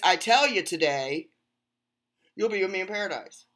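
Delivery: loud "I tell you today", then a quick "you'll be with me in paradise"; pause after today. Shown as a demonstration. Mocking the twisted reading to viewers, loud with a pause. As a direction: break after "today", then rush the rest. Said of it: "They added the emphasis".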